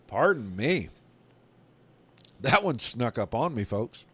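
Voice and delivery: throat still catching after a bug; quiet, recovering from swallowing a bug; just swallowed a bug. Real, not acted. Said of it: "felt like I swallowed a bug".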